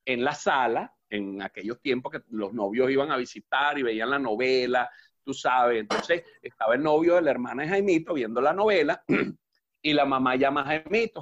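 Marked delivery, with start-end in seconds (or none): none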